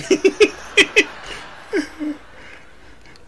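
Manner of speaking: high cackling laugh. Real, not acted. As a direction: let out a squealing laugh. Reactor laughing.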